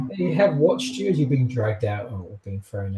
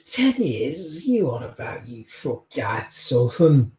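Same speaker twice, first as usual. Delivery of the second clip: put-on thick Scottish accent; 2 semitones higher than usual. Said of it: "such a thick accent".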